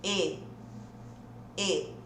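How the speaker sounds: E as a closed, low-pitched ê. Shown as a demonstration. Teacher has students repeat the sound, low closed ê. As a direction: say "E" closed, as with a circumflex. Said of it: "tem som de E".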